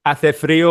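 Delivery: "Hace frío" said with a Spanish th sound; Spanish phrase. Shown as a demonstration. Confirming the friend's Spanish phrase, clearly spoken. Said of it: "like you say".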